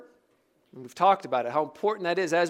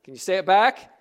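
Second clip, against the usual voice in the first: ordinary delivery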